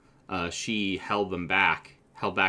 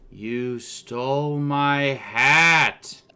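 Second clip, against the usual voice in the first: slow, loud, outraged croak of a complaint; a hammed-up frog-man accusing; indignant bullywug; 5 semitones higher, 7 dB louder, much slower than usual. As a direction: bellow the grievance very slowly. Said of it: "who looks at you and says".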